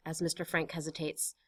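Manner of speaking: ordinary delivery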